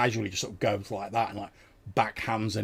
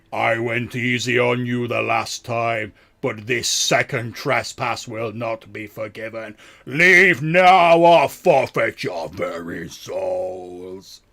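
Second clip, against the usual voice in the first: very loud, slow, commanding monster proclamation; booming moss voice; 12 dB louder, slower than usual. Acted. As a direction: boom out a threat, building to a drawn-out roar. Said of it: "booming out from the moss itself".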